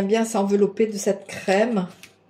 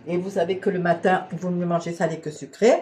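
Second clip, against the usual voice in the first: normal talking voice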